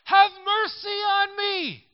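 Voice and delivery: very high, loud, slow desperate cry; drawn-out desperate shout. Acted. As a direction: cry with all he has. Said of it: "he only shouted louder".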